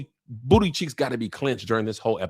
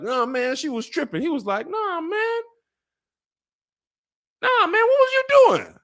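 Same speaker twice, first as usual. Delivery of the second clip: hyped rebuke. Retold or imitated. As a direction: call the lord out, hammed up.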